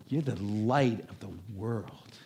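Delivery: slow, soft and low, a gentle blessing; tender declaration. Retold or imitated. Jesus to the ordinary crowd, a slow tender blessing. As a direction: lean in and bless them quietly.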